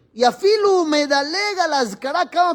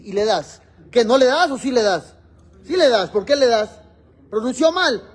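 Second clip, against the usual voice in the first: ordinary delivery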